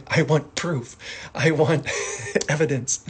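mock demanding with a laugh. Shown as a demonstration. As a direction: demand it, pushing for proof. Acting out demanding wants.

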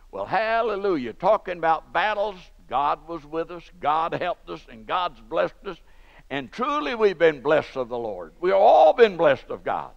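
loud jubilant preaching; preaching at full force. Acted. Preacher praising God before his congregation, loud. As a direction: lift the church with praise.

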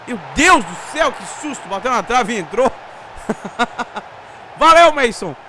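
loud relieved celebration; excited shout. Real, not acted. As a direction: cheer the goal. Goal hits post and goes in.

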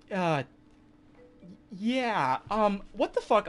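high, loud, thrown off balance; stunned disbelief. Retold or imitated. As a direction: react like the floor just vanished. Shocked reaction to parents.

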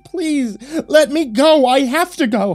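high, loud, desperate wail; sobbing plea. A narrator's reading. Restrained wife begging to go, sobbing plea. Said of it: "she began sobbing".

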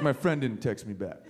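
low, sulky whine; mopey low voice. Retold or imitated. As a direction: whine it, totally deflated. Mopey human complaint.

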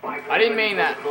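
high, loud hurried plea; yelped apology. Pretend. Gamer taking back his swearing, high loud yelp.

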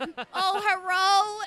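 high, loud cat yowl shaped like a word; imitated yowling cat; loud talking meow. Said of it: "he likes to randomly meow really loud".

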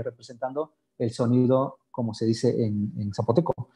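normal talking voice

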